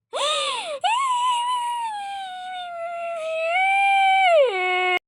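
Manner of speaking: long high loud wailing cry; drawn-out high wail of hurt tears. Acted. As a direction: burst into loud high sobbing. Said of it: "bien llorón".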